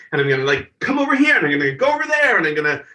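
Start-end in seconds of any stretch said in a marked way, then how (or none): 0.8-2.4 imagining the stage. Retold or imitated. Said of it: "I had it all pictured in my mind".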